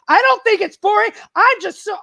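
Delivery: loud, high angry cry; blurting out rage that just popped; angry outburst. Retold or imitated. Venting to her friend after the elevator, loud angry outburst. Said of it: "I mean, I was so angry".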